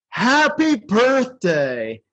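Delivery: high, drawn-out snowman discovering speech; Frosty. Acted. Frosty coming to life in an existential crisis, wondering high voice. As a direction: stretch each phrase with wonder, pitched high. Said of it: "how Frosty has, like, an existential crisis".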